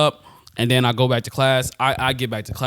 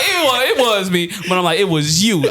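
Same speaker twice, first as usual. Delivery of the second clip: high, loud, breaking into laughter mid-line; giggling while retelling blaming the teacher; laughing while talking; 9 semitones higher, 6 dB louder, slower than usual. Real, not acted. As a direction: laugh through the words as you tell it. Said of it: "laughing at his own story".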